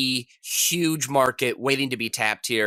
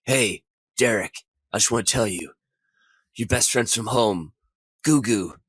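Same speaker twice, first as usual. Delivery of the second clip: somber; 4 semitones lower than usual. Retold or imitated. Friend from home breaking news in locker room, mock somber. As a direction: break it gently, with heavy mock solemnity. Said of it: "it's this very somber scene where he's like".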